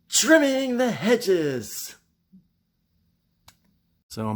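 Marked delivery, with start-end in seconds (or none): 0.1-1.9 high, slow announced title; drawn-out host announcement; episode title. Acted. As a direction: present it like a show title.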